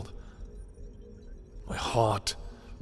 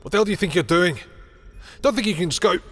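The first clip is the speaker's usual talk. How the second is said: high, loud outburst; angry confrontation; 9 semitones higher, 6 dB louder than usual. Acted. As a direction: call them back, outraged. Confronting someone who is leaving.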